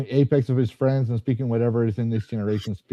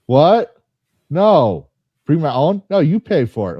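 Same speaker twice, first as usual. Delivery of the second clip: high, loud, hammed-up disbelief; mock incredulous objection; 4 semitones higher, 7 dB louder, slower than usual. Imagined union objecting to the cave job, mock incredulous. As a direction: play it outraged, each question jumping up.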